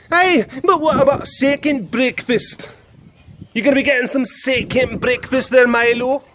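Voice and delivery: high, exaggerated Scottish accent; hammed-up Scottish lilt. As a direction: tease the pet in a broad Scottish accent. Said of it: "I don't like the Scottish accent".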